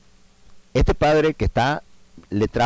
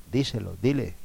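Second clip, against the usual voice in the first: normal talking voice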